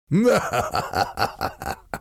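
high staccato evil laugh; hammed-up cackle trailing away; cold villain laugh. A narrator's reading. French envoy gloating over his threat, cold villain laugh. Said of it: "Chauvelin laughed again".

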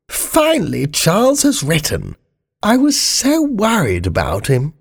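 very high-pitched Queen, relieved; high, relieved royal mother. Acted.

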